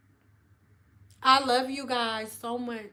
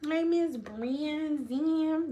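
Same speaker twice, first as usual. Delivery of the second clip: very slow, soft stretched greeting; playfully drawn-out name; 3 semitones higher, 6 dB quieter, much slower than usual. Shown as a demonstration. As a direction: draw "Brandon" out long and softly. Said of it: "That's how I say my last name".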